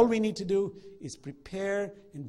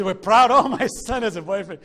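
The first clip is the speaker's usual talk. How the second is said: mock proud boast; 4 semitones higher, 14 dB louder than usual. Mocking a parent's proud boast.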